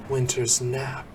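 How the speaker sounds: usual speaking voice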